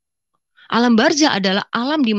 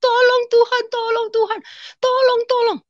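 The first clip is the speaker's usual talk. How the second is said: high, pleading cry to God; tearful plea; 14 semitones higher than usual. Retold or imitated. The dreamer crying out to God in the wasteland, weeping. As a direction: beg through tears. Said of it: "Saya menangis".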